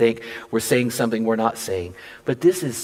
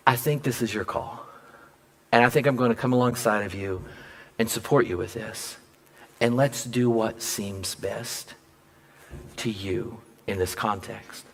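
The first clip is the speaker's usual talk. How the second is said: tender; 3 semitones lower, 7 dB quieter than usual. Retold or imitated. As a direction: quietly give her the decision. Conceding warmly to wife.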